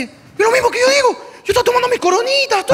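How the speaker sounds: high-pitched acted protest; hammed-up insistent wail; indignant sister-in-law. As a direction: protest your innocence in a high voice. Same reply redone as indignant sister-in-law.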